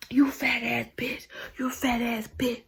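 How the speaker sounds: soft, slowed mock insult. Imitating the man who ran out of comebacks, soft mock insult. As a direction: mimic a feeble insult under the voice.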